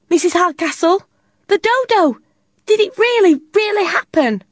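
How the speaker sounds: put-on high, breathless child's rush; upset and excited. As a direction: blurt it all out high. Upset child pouring out news.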